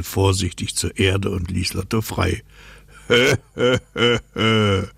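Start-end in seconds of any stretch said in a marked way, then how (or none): 3.1-4.9 hearty, raised ho-ho laugh; wind's booming storybook laugh; North Wind laugh. Acted. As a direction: laugh in four big puffs as the North Wind. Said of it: "hörte er den Nordwind lachen".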